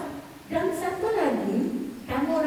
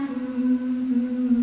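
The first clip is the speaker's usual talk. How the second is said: long monotone "hmm" of a stalled speaker; hesitant hmm; 4 semitones lower than usual. Shown as a demonstration. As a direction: demonstrate over-long filler humming. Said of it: "Jangan banyak".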